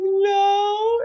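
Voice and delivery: put-on high, teary little-girl no; mock crying toddler. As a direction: pout it out like a sleepy toddler. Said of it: "this little girl's crying".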